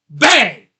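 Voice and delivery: sudden shouted bang, high and loud. Retold or imitated. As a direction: slam out the bang like the strap snapping. Tire breaking free retold.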